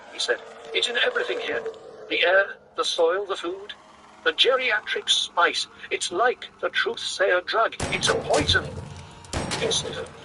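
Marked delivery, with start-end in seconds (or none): 0.7-8.6 agitated insistence. A narrator's reading. Paul agitated about spice.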